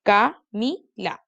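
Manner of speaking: "Camila" slowly split as CA-MI-LA; three separate beats on "Camila"; syllable by syllable. Shown as a demonstration. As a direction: chop "Camila" into slow syllables. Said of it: "Trisílaba es mi nombre".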